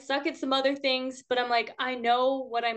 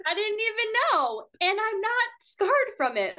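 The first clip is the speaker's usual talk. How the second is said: amused disbelief; 11 semitones higher than usual. Real, not acted. Surprised at mom's confession.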